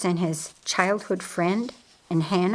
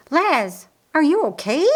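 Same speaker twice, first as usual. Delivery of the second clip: high, louder worried question; alarmed concern; 7 semitones higher, 5 dB louder than usual. A narrator's reading. Mary sees her brother faltering, alarmed concern. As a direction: check on him, worried.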